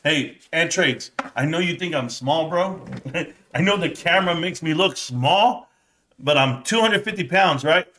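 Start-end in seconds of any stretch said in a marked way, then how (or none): none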